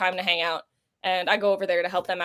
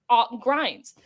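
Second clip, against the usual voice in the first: ordinary talk, nothing marked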